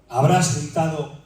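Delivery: normal talking voice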